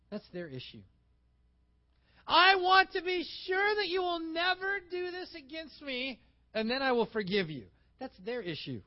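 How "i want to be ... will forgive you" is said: 1 demanding shout. Acted. Demanding offended party quoted.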